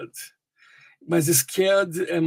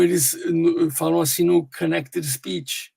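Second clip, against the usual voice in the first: normal talking voice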